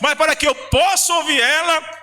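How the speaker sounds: pregação fervorosa. Real, not acted. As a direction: preach it with mounting fervour to the congregation. Preaching at full force. (pregação inflamada)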